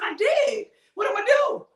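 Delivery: a hint of a flustered church sister, half laughing; Sister Helen's voice. Voicing Sister Helen's reply.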